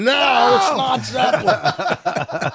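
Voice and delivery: hammed-up shouted no; mock stage shout. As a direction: call it out like a bandleader fixing a wrong guess. Acted stage correction, shouting.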